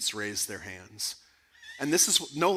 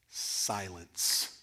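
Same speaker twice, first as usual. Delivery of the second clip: low, soft, dramatic single word; hushed punchline; 7 semitones lower, 8 dB quieter than usual. Real, not acted. Teacher reveals the professor's response, hushed and low. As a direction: drop it low and quiet. Dramatic answer to his own question.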